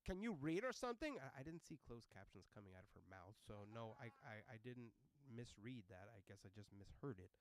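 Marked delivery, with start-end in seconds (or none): 0.1-1.2 high, loud jab; snippy lady passenger. Retold or imitated. Passenger berating the driver, loud sarcasm. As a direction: ask it as an insult. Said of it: "she was even like".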